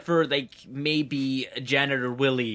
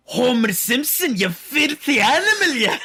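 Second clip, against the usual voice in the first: laughing, high and loud Scottish accent impression; a hammed-up Scottish Marge yelling through laughter; thick Scottish accent; 8 semitones higher, 7 dB louder than usual. Retelling a sketch, in a laughing thick Scottish accent. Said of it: "in this really thick accent".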